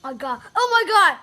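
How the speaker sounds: loud, high gasp of shock; shocked outburst. Real, not acted. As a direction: blurt it out as the sourness hits. First bite of sour candy.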